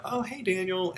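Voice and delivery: very high, chummy greeting; mock a too-familiar new hire; new employee greeting the boss like a pal, hammed-up and high; overly casual buddy. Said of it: "they're talking to me like we're buddies".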